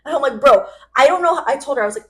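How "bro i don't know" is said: loud, exasperated outburst; mild retold yell of frustration; fed-up yelling. Telling off a friend who won't understand, fed up. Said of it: "screaming at her 'cause I'm so fed up".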